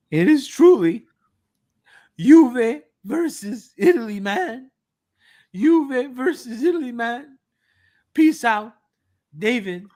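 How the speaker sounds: hammed-up emotional plea, high and loud; emotional Juventini. Closing the dramatic tweet reading for co-hosts, hammed-up emotional Juventini voice. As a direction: build to an overwrought fan's sign-off, pausing for drama. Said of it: "read it in your emotional Juventini voice".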